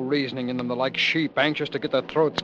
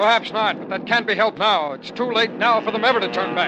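higher, raised voice over noise; urgent raised voice; 9 semitones higher, 5 dB louder than usual. Acted. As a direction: speak up over the bombs. Shouting over bombardment.

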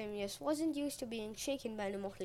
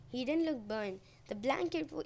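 usual speaking voice

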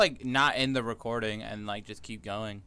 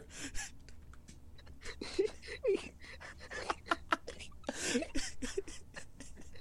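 breathy high helpless laughing; long wheezing laugh; 11 dB quieter than usual. Real, not acted.

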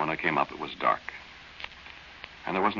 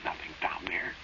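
whispered and much softer, afraid of being heard; scared whisper; 6 dB quieter than usual. Acted. Boy to Dorothy at night by the well, scared whisper. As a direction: hush it, something is out there in the dark.

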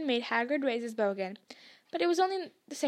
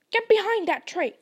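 higher urgent command; urgent order; 7 semitones higher, 7 dB louder than usual. A narrator's reading. Hagrid ordering the children behind a tree, urgent. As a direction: order them to take cover now.

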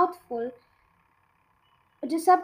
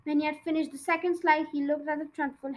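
ordinary delivery

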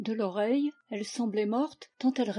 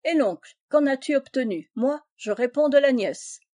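louder, self-satisfied boast; smug; 4 semitones higher, 5 dB louder than usual. A narrator's reading. Young man brags to his friend Rivet, smug and louder. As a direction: crow about it like a fop. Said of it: "avec fatuité".

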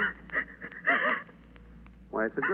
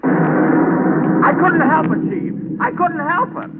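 panicked; 12 semitones higher, 3 dB louder than usual. Acted. Angel loses horn, panics.

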